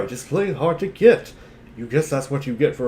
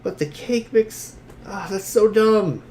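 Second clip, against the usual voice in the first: higher and slowed, drawn-out groan of disdain; whiny complaint; 7 semitones higher, slower than usual. Acted. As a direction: moan that it's all so dumb. Complaining about the cake mix.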